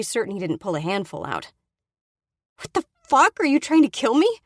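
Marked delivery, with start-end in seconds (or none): 2.6-4.4 shrieking high and loud in shock; furious shout. Acted. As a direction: yell at him, outraged and shaken. Ambushed, yanked off her bike.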